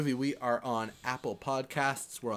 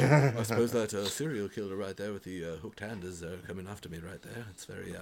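put-on lower, softer British accent, calmly understated about danger; lightly voiced British accent, getting quieter; posh British; 4 semitones lower, 4 dB quieter than usual. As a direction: play it politely unbothered, very English. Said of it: "a movie with Hugh Grant as Rod Lane".

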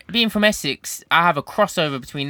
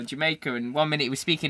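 usual speaking voice

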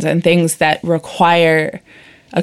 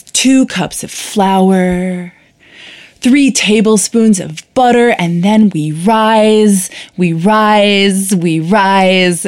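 slam poetry voice; 3 semitones higher, 4 dB louder, much slower than usual. Retold or imitated. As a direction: stretch each phrase like a grand slam poem. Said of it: "in a slam poetry voice".

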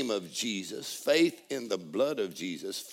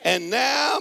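full-force preaching; 10 semitones higher, 8 dB louder than usual. Acted. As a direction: preach it out like a revival climax.

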